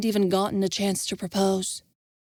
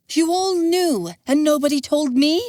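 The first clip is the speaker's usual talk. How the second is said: hurt anger; 8 semitones higher, 5 dB louder than usual. Acted. Envis accusing the group, hurt anger. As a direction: demand it, wounded and furious. Envis confronting his friends.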